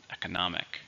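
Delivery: "economic" with the stress on NOM; stress on third syllable. Shown as a demonstration. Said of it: "stress on the third syllable".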